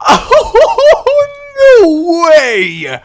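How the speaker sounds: very high, drawn-out loud exclamation; excited disbelief. Real, not acted. Gamer reacting to a lucky start, excited disbelief. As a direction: cry it out high and loud in amazement.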